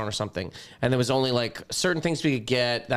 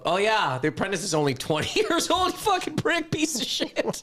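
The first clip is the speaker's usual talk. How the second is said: mock outrage; 9 semitones higher than usual. Mock retort to TV apology.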